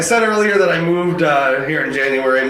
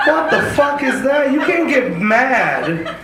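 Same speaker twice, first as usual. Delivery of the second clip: incredulous outburst; 10 semitones higher, slower than usual. Real, not acted.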